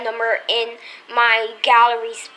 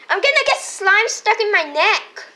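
higher louder whine; whiny toy voice; 7 semitones higher, 6 dB louder than usual. Pretend. Toy complains, louder repeat.

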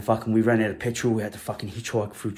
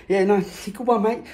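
higher, laughing sarcastic reply; mocking laugh; 10 semitones higher, slower than usual. Teen laughing off the gun as a joke.

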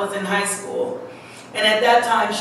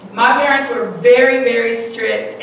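ordinary talk

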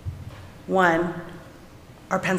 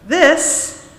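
stern teacher; 6 semitones higher, 5 dB louder than usual. A narrator's reading. Stern teacher scolding the boy.

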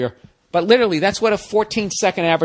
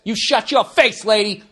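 mock angry retort; 3 semitones higher than usual. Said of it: "acted quarrel climax".

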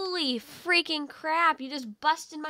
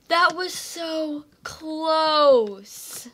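relieved; 8 dB louder, much slower than usual. Real, not acted. Relief after near miss.

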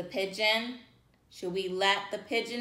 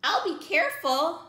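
begging pigeon; 9 semitones higher, much slower than usual. Acted.

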